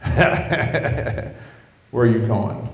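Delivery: mock laugh running into the question; scoffing laugh, then the question; laughing disbelief. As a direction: laugh it off, imitating a disbelieving girlfriend. Girlfriend laughs at the answer.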